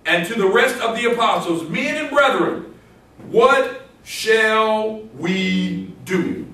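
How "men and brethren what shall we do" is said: solemnly slow scripture question with long held words; slow, drawn-out reading. Acted. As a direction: stretch each word out, word by word. Reading scripture aloud to congregation.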